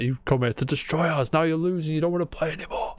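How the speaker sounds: high, mocking anime rival; Vegeta impression. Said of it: "some of the stuff he says".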